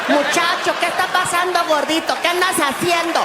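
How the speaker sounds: high, fast Spanish shouts of alarm; frantic housekeeper yelling at the racket; shouting housekeeper. Retold or imitated. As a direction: ama de llaves gritando alarmada.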